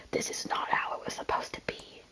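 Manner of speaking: a soft, breathy tree's whisper; hushed, sorrowful whisper. Acted. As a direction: whisper it like wind through leaves. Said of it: "whispered the tree".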